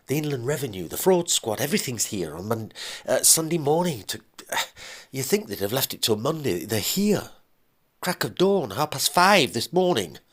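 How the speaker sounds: a man blurting out bad news in a rush; husband panics to his ex-wife about the fraud squad; panicked husband. Husband's voice again on the phone.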